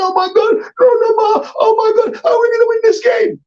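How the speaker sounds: very high panicked wail; frightened fans. Retold or imitated. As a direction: wail it like a scared fan. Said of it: "everybody's frightened".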